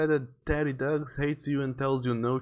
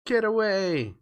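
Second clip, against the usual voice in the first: put-on high child's cry of alarm; young Mikey; 8 semitones higher than usual. Frightened boy to a creature, high-pitched alarm. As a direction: cry out in a raised little-boy voice. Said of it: "goes young Mikey".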